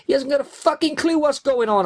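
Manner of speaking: high, frantic yell; screaming. A narrator's reading. Driver screaming at his accomplice while cornering hard, screaming. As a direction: scream it in exasperated panic. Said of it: "I screamed and cornered the van".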